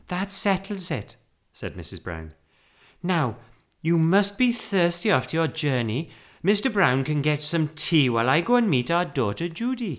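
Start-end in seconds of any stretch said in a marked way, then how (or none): 0.1-1.2 higher-pitched, decisive lady; lightly voiced Mrs Brown. A narrator's reading. Woman deciding about the stray bear, decisive. 3.0-9.9 higher, louder, organising lady; brisk Mrs Brown. A narrator's reading. Wife organising tea for the bear, brisk.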